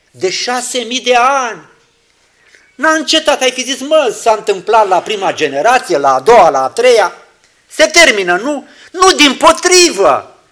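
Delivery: high, loud fervent sermon; full-force preaching. Acted. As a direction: proclaim it with fire.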